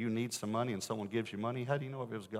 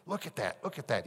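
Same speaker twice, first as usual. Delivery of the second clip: pointing it out with delight; excited; 3 semitones higher than usual. Retold or imitated. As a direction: pastor thrilled by a record offering, eager. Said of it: "he was excited".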